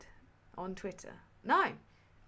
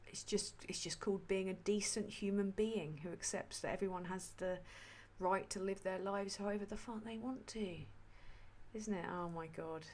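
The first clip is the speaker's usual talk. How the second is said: hushed whisper; 3 semitones lower, 10 dB quieter than usual. Real, not acted. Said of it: "is this A S M R?".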